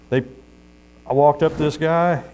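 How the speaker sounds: ordinary talk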